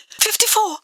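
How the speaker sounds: breathless frightened gasp, pitched high and soft; old woman gasping in fear. A narrator's reading. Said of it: "she gasped".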